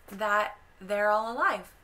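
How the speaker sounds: ordinary talk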